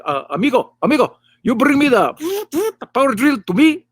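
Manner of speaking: a clumsy gringo's put-on Mexican accent; mock Mexican accent. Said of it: "talks to him in English with a Mexican accent".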